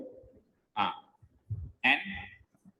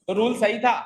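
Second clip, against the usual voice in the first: emphatic; 7 semitones higher, 6 dB louder than usual. Real, not acted. Teacher stressing the key point.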